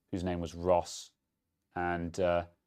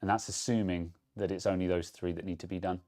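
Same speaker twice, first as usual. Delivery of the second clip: ordinary talk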